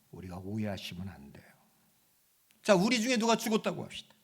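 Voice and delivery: ordinary talk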